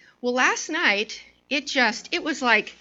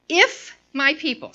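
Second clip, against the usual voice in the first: "If" set apart, punched higher; stress on IF; 4 semitones higher than usual. Shown as a demonstration. Preacher showing the if is important, stressing "If". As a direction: lean on "If", then pause briefly. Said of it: "so the if is important".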